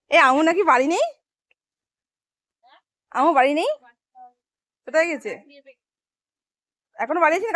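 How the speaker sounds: loud, high call across the yard. Real, not acted. Calling loudly across the garden to a relative in the house, high and loud.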